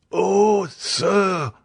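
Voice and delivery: high, pleading cry of a sick man; high, frightened plea; desperate cry. A narrator's reading. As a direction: beg the doctor in terror. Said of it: "cried Barrois".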